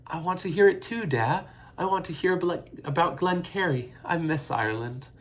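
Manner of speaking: put-on high, pleading little girl; pleading child. A narrator's reading. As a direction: beg to hear the story. Child begging father.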